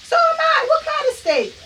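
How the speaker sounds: high, loud excited squeal; sister squealing. Retold or imitated. Cook imitates her sister squealing about the same steak. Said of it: "She's like".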